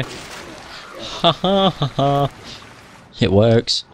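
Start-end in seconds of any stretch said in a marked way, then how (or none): 0.0-2.3 slow, soft spelled-out taunting laugh; a slow, quiet gloating cackle; mock laugh. Player taunting a killed enemy with a slow mock laugh.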